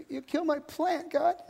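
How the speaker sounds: high, pouting whine at normal volume on "you kill my plant"; high-pitched childish whimper; sulky little kid. Acted. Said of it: "like a little kid digging at the dirt".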